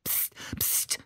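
sharp whispered shushing; hissed hush. A narrator's reading. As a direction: hiss for silence under your breath. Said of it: "zischte Ali". (gezischtes, geflüstertes Psst)

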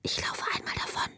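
secretive whisper. A narrator's reading. Little girl confides her escape plan to Greta, whispering. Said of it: "flüsterte sie ihr zu".

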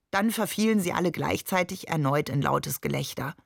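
usual speaking voice

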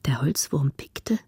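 hushed storyteller's whisper; whispered narration. A narrator's reading. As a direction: draw listeners into the dark silence. (geflüstertes Erzählen, unheimlich)

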